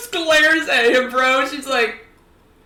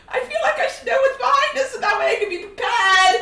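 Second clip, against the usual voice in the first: high, rushed, panicky babble; horrified; 10 semitones higher, faster than usual. Acted. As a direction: rush it out in high-pitched horror. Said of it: "She's horrified".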